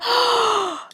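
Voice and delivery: high sharp gasp; shocked gasp. Retold or imitated. Finding her daughter's haircut, gasping.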